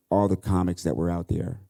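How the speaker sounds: ordinary delivery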